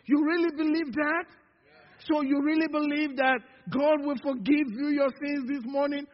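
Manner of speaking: usual speaking voice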